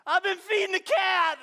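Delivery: high, loud anguished cry; wife confessing in despair; wailing confession. Retold or imitated. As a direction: wail it out like a guilty confession. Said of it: "she was like".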